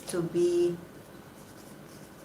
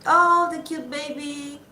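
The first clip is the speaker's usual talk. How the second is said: high, louder mock baby-talk coo; 8 semitones higher, 5 dB louder, slower than usual. Members coddling the youngest, mock cooing baby talk. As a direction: fuss over him like a cute baby. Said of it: "is like".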